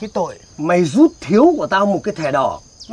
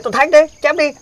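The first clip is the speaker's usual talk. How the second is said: louder and higher, pushing the dare; thách thức to hơn; 6 semitones higher, 5 dB louder than usual. Acted. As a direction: shove the dare harder in his face. Dares the referee again, louder. (quát to, giọng cao thách thức)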